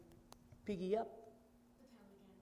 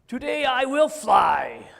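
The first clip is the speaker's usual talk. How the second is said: loud, slow, high pig voice; slow shouted piggy vow; excited Piggy; 3 semitones higher, 10 dB louder than usual. Acted. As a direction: proclaim it like a determined little pig. Piggy declares she will fly.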